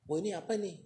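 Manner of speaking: imitating the frightened soul, lightly; mock fright. Soul shown hell, a hint of fright. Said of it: "Wah, ketakutan dia".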